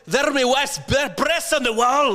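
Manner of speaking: very high mock-Scottish outcry; high-pitched cartoon Scottish wail; groundskeeper Willie Scottish. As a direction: go over the top with a bad Scottish accent. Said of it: "You just go full Willy".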